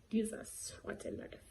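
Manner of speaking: soft resigned "Jesus, what a life"; quiet sigh. Retold or imitated. Slapped by the nun, a soft defeated sigh. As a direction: let it fade out, defeated. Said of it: "I just said".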